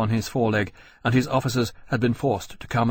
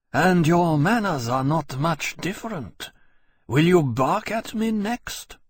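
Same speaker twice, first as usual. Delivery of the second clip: cool disdain; 7 semitones higher than usual. A narrator's reading. Lien to Temeraire, cool and disdainful. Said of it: "cool and disdainful in fluent French".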